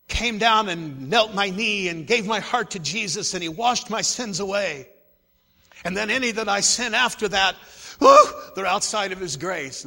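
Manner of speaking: a hammed-up churchgoer's complacency; mock believer. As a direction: parody someone who thinks they're safe. Mocking a mistaken believer's view.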